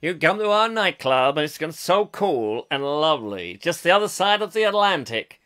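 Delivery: hyped-up American promoter voice; American accent. Acted. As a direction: sell it big in an American accent. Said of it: "In America, they'd say".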